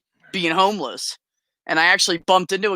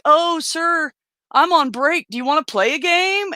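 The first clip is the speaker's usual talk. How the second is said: higher, sweet mock-innocent offer; innocent girl bait; 11 semitones higher than usual. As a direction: play the harmless girl to hustle him. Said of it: "I'd walk up and be like".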